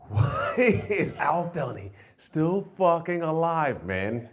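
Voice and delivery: laughing through a mock plea to God; half-laughing, slow, asking God why; laughing lament. Comic asking God why the friend lives, laughing.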